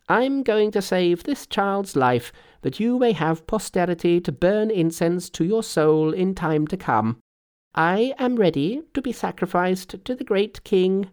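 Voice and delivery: high-pitched, emphatic Monkey voice; resolute Monkey. A narrator's reading. Monkey offering himself for sacrifice, bold and resolute.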